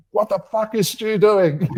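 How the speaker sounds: high, loud exasperated cry; mock exasperated boys. Mimicking the boys venting about Stu, high and loud. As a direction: throw up your hands in disbelief. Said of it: "peacemaker personality of Perry between us going".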